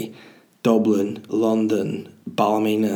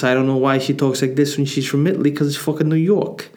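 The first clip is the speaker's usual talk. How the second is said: put-on imagined Italian mother in a New York accent; 4 semitones higher than usual. Comic picturing a listener's Italian mother, put-on New York accent. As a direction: talk like a New York Italian mum, slightly raised. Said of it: "talking like this".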